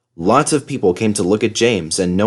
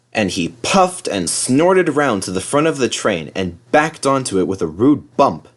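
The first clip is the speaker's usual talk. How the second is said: ordinary delivery, nothing marked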